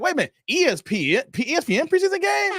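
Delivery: astonished at a preseason game on ESPN; rising disbelief. Retold or imitated. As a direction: build from a double take to squeaky amazement. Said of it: "I'm like".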